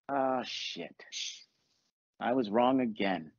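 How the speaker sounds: low, slow sinking realisation; resigned admission. Retold or imitated. Man realising he was wrong again, resigned admission. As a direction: own up with a deflated drop.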